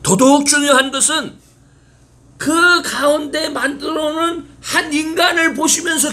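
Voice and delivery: high, loud pulpit voice; full-force sermon climax; fervent preaching. Acted. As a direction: proclaim it to the congregation.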